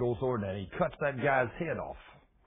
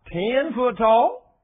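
high, loud and very slow "Ten foot tall?" rising; incredulous repeat; 8 semitones higher, 9 dB louder, much slower than usual. Shown as a demonstration. Preacher marvelling at Goliath's size, slow rising incredulity. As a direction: stretch "tall" in disbelief, rising.

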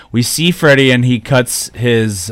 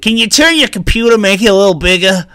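higher, mock granny asking for help; old lady voice; 9 semitones higher than usual. Pretend senior asking son for help, high granny voice. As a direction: plead like a grandma with a computer.

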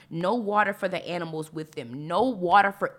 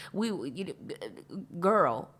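ordinary delivery

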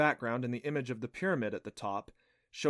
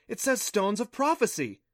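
high, louder thrilled announcement; gleaming excitement; 9 semitones higher, 5 dB louder than usual. Acted. Clancy reveals what the tablet says, thrilled and high. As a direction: announce the find with delight. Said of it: "eyes gleaming".

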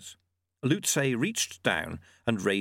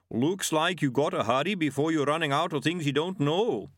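higher, odd creature voice; yeti, wry; 5 semitones higher than usual. Acted. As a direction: play a drily teasing yeti.

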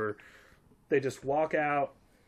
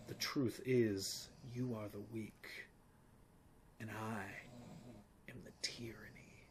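Samuel L. Jackson quote; 3 semitones lower, 14 dB quieter than usual. Retold or imitated. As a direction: deliver the verse in a dramatic whisper. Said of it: "Samuel L. Jackson's".

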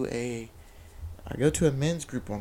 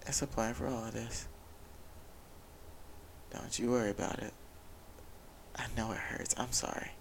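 gentle comfort; 8 dB quieter than usual. Retold or imitated. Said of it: "he'll remind you".

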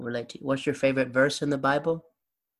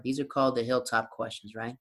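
usual speaking voice

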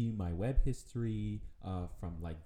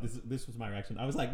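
ordinary talk

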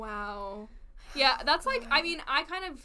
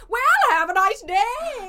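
high, loud, slow prim goodbye; mock genteel farewell; 11 semitones higher, 11 dB louder, slower than usual. Friend imitates her awkward exit line, prim high farewell. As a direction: sing out a prissy have a nice day. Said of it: "I know you did the voice".

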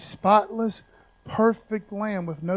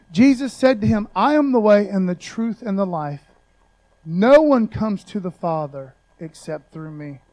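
preached scripture; 9 dB louder than usual. Acted. Scripture read at full force.

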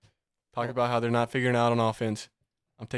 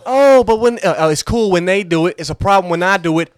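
indignant protest; 6 semitones higher, 9 dB louder than usual. Real, not acted. Defending himself, heated.

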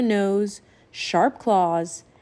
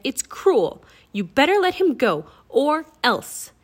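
indignant demand; 5 semitones higher, 5 dB louder than usual. A narrator's reading. Jack confronts the captor.

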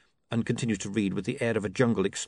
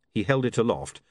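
ordinary delivery

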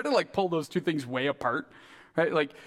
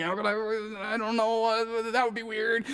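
mock-flustered dithering, slightly higher; scrambling thoughts; 4 semitones higher than usual. Believer avoiding talk about Jesus, flustered. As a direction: play someone fumbling for an excuse. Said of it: "scrambling in your mind".

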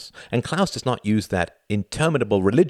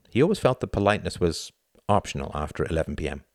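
usual speaking voice

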